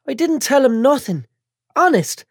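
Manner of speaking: put-on high, tearful, whiny girl; a bandaged girl fighting tears; whiny young woman. Chantal voiced.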